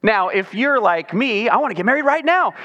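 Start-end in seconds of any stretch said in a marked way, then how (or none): none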